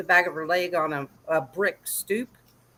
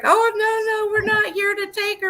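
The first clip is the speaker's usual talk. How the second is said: flustered caseworker; 12 semitones higher than usual. Retold or imitated. Dad mimics the caseworker backpedalling after his offer, high and flustered. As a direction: squeak out a panicked backpedal in a higher voice. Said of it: "she's like".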